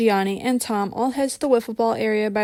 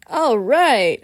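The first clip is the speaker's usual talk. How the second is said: excited yell; 2 semitones higher, 6 dB louder than usual. A narrator's reading. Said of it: "a few guys yell in excitement".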